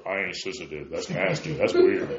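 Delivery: low, soft mock tough-guy grumble; tough guy mockery. Preacher mocking guys scorning the word sensitive, in a low mock grumble. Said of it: "we don't like that word".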